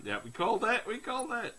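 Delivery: loud triumphant cheer; excited. Real, not acted. Voorspelling komt uit, gejuich.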